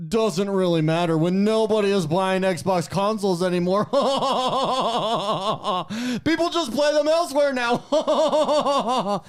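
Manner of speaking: high, slow, laughing snob voice; snobby voice breaking into laughter. Retold or imitated. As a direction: try to stay snobby but crack up laughing. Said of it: "that was my snobby voice".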